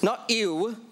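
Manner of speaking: a playful "ew" said with a grimace and a laugh; laughing "ew" of mock disgust; a light grossed-out "ew" with a chuckle. Shown as a demonstration. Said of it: "the ooh of God".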